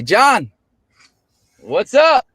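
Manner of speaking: high, loud shout across to a buddy; big high-pitched holler of greeting; hearty called greeting. Retold or imitated. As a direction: call out an exuberant hello. Acted greeting to gym member John.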